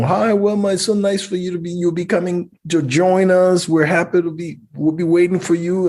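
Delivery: high, bright phone greeting imitating the host mom; a cheerful host mom greeting on the phone.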